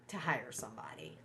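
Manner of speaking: unvoiced whispered aside. Real, not acted. Whispering to viewers she won't hire anyone. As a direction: drop to a secret whisper.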